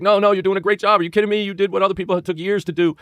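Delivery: high-pitched, insistent encouragement; retold supportive reassurance. Board members consoling a crying trader, eagerly supportive. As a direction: imitate colleagues rushing to reassure someone. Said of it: "he's really being supportive".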